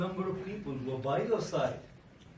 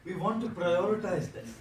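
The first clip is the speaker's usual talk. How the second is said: normal talking voice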